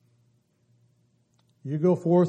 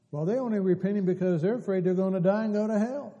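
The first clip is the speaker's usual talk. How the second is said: rising preaching; 6 semitones higher than usual. Acted. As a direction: lift it like a revival sermon. Preacher rising to full force.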